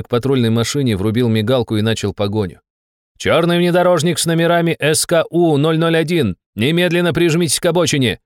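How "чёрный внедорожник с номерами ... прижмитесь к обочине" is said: high, insistent police announcement; loudhailer bellow. A narrator's reading. Pursuing inspector orders the jeep over, bellowing through a loudhailer. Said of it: "орал матюгальник где-то позади".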